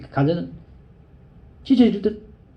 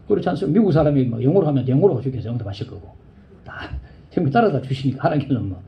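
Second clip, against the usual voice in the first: normal talking voice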